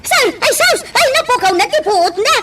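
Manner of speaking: high, loud panicked cries. Acted. Nurse panicking over the fainted patient, high frantic cries. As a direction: shriek for help in alarm.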